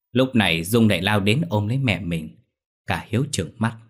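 ordinary delivery

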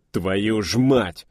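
slow, furious outburst; angry curse. A narrator's reading. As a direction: spit the curse out in pain and rage. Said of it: "Не думая, Чак перешёл на русский".

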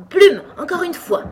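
high, loud repeated order; crying out. A narrator's reading. Sheep repeating her order to Alice, crying out. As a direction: call it out insistently.

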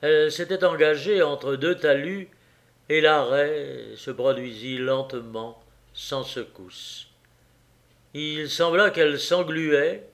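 ordinary delivery

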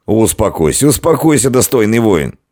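high, flustered pleading; nervous, hurried calming; anxious placating. A narrator's reading. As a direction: urgently beg a drunk to settle down. Innkeeper trying to calm shouting warrior.

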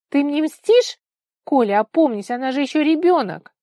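high, loud, whiny accusation; indignant reproach. A narrator's reading. Vika pleads and accuses in quarrel. (возмущённый упрёк, громко и высоко)

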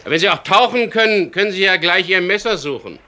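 very high, loud sarcastic sing-song; mocking threat. Skipper taunting the thief before tossing him overboard, mocking.

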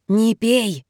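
raspy, creaking voice; creaky voice. Acted. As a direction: croak it like an old hinge. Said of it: "скрипучий голос". (скрипучий голос)

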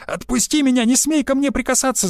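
high-pitched indignant squeal. A narrator's reading. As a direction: squeal it, voice shooting far up. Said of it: "завизжала Юлиана". (возмущённый высокий визг)

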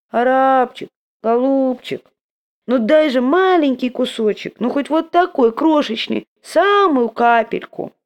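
high, slow whining plea; humble begging voice. Acted. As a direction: grovel for a crumb. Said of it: "тот же униженный нищенский голос".

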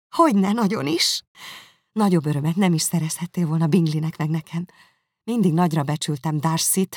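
glowing approval; Jane delighted. Acted. Jane answers warmly.

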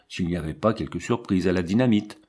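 usual speaking voice

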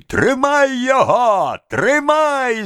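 high, drawn-out angry yell; cracked, whiny call to seize someone; spiteful, whining cry. A narrator's reading. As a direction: cry out high, malicious and tearful. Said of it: "закричал мужской, надтреснутый, злобный, плаксивый голос".

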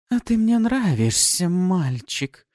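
high, loud, purring; seductive demon. Acted. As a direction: flirt with hunger. Succubus flirts.

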